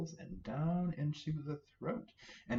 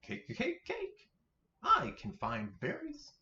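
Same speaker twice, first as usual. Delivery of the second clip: tiny squeaky bunny, much higher; little bunny voice. Acted. Minnie Rabbit to Mother Rabbit, squeaky high bunny voice. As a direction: chirp it like an eager baby rabbit. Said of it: "Minnie Rabbit says".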